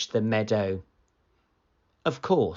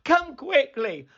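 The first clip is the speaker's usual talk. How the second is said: high, urgent shout. Acted. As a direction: call out to the village in alarm. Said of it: "he shouted".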